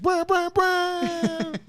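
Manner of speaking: long high trilled brr; brr sound. Retold or imitated. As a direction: roll out a slow high brr. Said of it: "We gonna be like".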